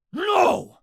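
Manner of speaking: high, loud commanding shout; boomed. A narrator's reading. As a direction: stop the cronies with one shout. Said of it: "Mad Dog boomed".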